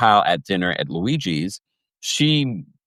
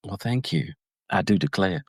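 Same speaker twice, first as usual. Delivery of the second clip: put-on Southern accent; 5 dB quieter than usual. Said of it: "loving my, my Southern accent".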